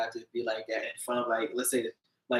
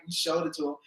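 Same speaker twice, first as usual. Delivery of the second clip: usual speaking voice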